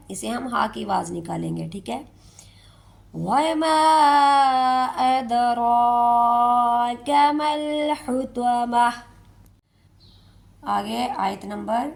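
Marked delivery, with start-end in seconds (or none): 3.1-8.9 Quran recitation. Shown as a demonstration. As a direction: recite slowly, melodic. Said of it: "recitation demo". (آہستہ تلاوت)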